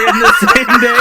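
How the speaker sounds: breaking into laughter mid-line; laughing. Real, not acted. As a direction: laugh through the words. Laughing during confession.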